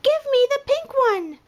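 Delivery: high penguin voice. A narrator's reading. Penguin asks panda for the pink donut, high and demanding. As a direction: squeak it like a greedy little penguin. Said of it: "The penguin says".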